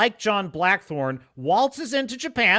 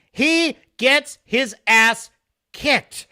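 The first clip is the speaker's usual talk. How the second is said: higher, louder and very slow, every word hammered; loud emphatic repeat; 7 semitones higher, 7 dB louder, much slower than usual. Real, not acted. A reviewer repeating his point, louder and drawn out. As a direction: belt it out like the punchline.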